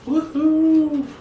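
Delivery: high delighted whoop; excited cheer. Real, not acted. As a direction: whoop it out, thrilled. Cheering at Noreen's remark.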